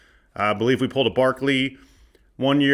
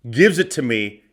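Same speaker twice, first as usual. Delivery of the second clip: ordinary talk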